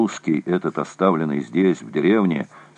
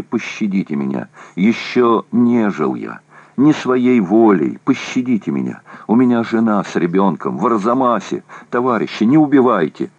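desperate pleading; 4 semitones higher, 3 dB louder than usual. A narrator's reading. Prisoner begs for his life.

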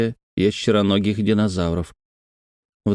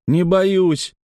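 put-on raised-pitch child's denial; defiant boy; 9 semitones higher than usual. A narrator's reading. Brother denies being scared to his sister, high defiant voice. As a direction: deny it as a needled little boy. Детская перепалка, брат возражает.

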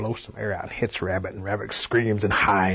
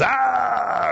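high, loud, long "aah"; scream of pain; 13 semitones higher, 5 dB louder than usual. A narrator's reading. Rabbit rips fur off his belly, pained scream. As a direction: cry out high as if stung. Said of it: "he screams".

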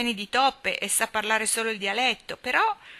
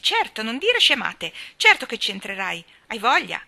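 louder brusque reassurance; snapping back; 4 semitones higher, 7 dB louder than usual. Acted. Mary to Colin, brushing off his fears louder and brusquely. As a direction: brush off his fear sharply. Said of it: "scattò Mary".

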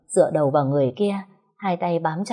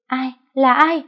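much higher, louder terrified cry; frightened scream; 9 semitones higher, 6 dB louder, slower than usual. A narrator's reading. Liên screaming at someone hidden in the banana bushes, terrified. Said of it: "cô ấy hét toáng lên".

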